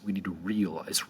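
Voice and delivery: ordinary delivery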